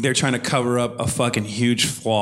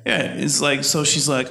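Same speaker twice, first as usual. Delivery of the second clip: normal talking voice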